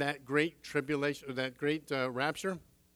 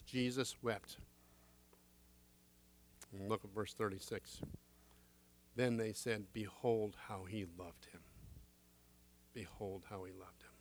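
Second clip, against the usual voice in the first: hushed reverence; 6 semitones lower, 9 dB quieter than usual. Acted. Reading the weeping passage softly.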